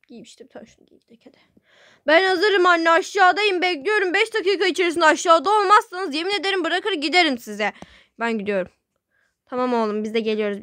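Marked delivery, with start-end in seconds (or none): 0.1-1.4 hurried whisper to herself; fast under-breath whisper, much softer. Acted. Girl deciding on an outfit, fast whispered to herself. Muttering while dressing. 2.1-7.7 higher, louder impatient yell; calling out. Acted. Teen yelling to her mother that she's ready, loud and impatient.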